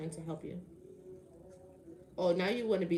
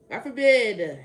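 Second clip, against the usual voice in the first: very loud sarcastic exclamation; exasperated outburst; 2 semitones higher, 13 dB louder, slower than usual. Real, not acted. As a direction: belt it out in exasperation. Repeats sarcastic protest louder.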